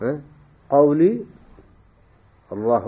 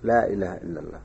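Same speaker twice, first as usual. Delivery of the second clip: quoted softly; 9 semitones lower, 8 dB quieter than usual. Shown as a demonstration. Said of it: "ما هو قولك؟".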